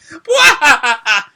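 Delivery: high, loud burst of laughter; wild laugh. Acted. Lucy bursts out laughing at Ryuji's outburst, high and loud. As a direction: throw your head back and cackle. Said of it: "Lucy is now just laughing wildly".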